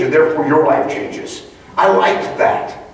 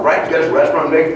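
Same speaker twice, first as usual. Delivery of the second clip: normal talking voice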